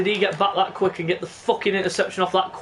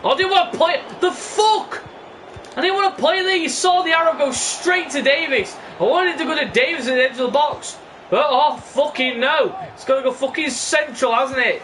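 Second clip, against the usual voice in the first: exasperated high-pitched ranting; frustrated rant; 8 semitones higher than usual. Real, not acted. Ranting at game pass direction.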